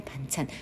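パンチャン said softly as a Korean model word; パンチャン, Korean pronunciation, no Japanese vowels; Korean banchan. Shown as a demonstration.

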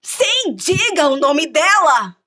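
high, loud, slow command; demanding queen. Acted. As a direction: insist on the name, drawn out.

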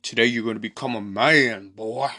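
lightly voiced menacing father; a hint of a domineering dad addressing his son; Krug. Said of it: "And then Krug's like".

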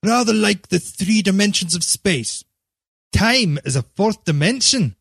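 shy author explaining his tale; lightly voiced, embarrassed Wells; embarrassed writer Wells. A narrator's reading.

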